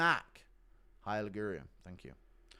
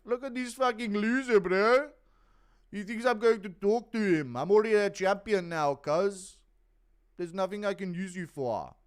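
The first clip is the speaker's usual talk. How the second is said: higher, loud scornful champion voice; annoyed DDP impression; 8 semitones higher, 7 dB louder than usual. Streamer as DDP mocking a fan's message, loud and scornful. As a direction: sneer down at a nobody, loud. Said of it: "impression of D D P ... getting annoyed".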